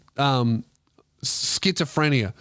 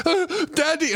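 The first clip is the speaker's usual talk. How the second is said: put-on much higher breathless child crying for daddy; the half-drowned boy calls his dad; gasping kid; 14 semitones higher than usual. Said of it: "He's gasping, crying".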